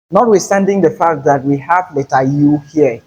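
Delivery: normal talking voice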